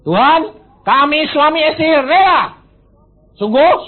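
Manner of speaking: loud, high fervent declaration; fervent loud prayer of surrender. Retold or imitated. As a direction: cry it out to God. Retold prayer and God's reply.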